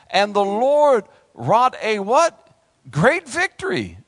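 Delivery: slow, loud, high scripture proclamation; triumphant sermon reading; preaching at full force. Acted. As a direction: call out the verse for them to finish.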